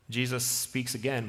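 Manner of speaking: normal talking voice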